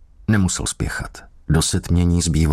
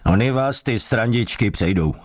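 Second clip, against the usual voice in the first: higher, a touch of furious sergeant; angry threat; 6 semitones higher than usual. A narrator's reading. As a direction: spit the warning out. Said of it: "odhodil strážmistr vztekle". (strážmistr vzteklé varování)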